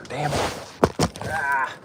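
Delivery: ordinary talk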